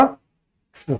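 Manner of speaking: एक्स as a soft voiceless ks, no vowel; bare ks sound. Shown as a demonstration. Teacher answering her own question with the voiceless ks sound. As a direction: breathe out एक्स as ks. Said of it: "तो इसका pronunciation क्या होगा".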